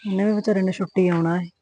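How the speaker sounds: low, soft musing under her breath; wife worrying to herself after the phone call, low and soft; muttering to herself. Acted.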